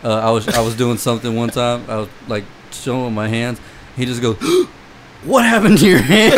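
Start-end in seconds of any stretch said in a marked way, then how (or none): 4.4-6.3 loud high-pitched imitation of a shocked kid; mock alarm, loud and high; shocked, disgusted worry. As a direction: mimic a horrified question, voice jumping up. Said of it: "almost like a disgust slash worry".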